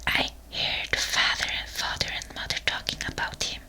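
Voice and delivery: usual speaking voice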